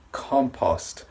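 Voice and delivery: compost, stress on COM, open ah vowel, T at the end; compost demo. Shown as a demonstration. Teacher modelling compost for learners. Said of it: "is pronounced compost".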